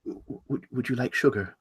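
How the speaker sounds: very soft, stammered question; hesitant offer. Acted. Host offering guest sugar, hesitant. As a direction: offer it quietly, unsure of yourself.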